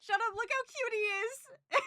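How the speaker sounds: very high, tearful squeak; emotional, tearful. Real, not acted. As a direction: squeal through tears over something adorable. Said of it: "I'm getting emotional now".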